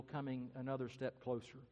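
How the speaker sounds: ordinary talk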